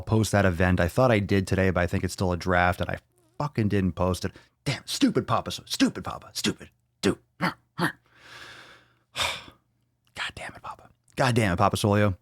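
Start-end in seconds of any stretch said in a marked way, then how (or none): 4.6-12.2 hushed, muttered scolding of himself; mock self-scolding. Podcast host muttering at himself for forgetting the post, hushed. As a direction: scold yourself under your breath, half joking.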